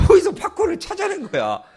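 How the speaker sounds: high, astonished exclamation; amazed. Real, not acted. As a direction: marvel at where he found it. Amazed the boy found popcorn.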